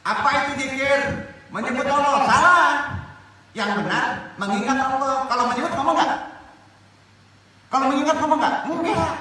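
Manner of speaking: high, loud, ringing challenge to the congregation; forceful preaching. Real, not acted. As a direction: fire questions at them at full voice.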